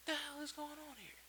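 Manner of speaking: high, exasperated squeak of confusion; the hell, half laughing in disbelief; baffled outburst. Real, not acted. Tutorial maker baffled by his own switch setup, squeaking high.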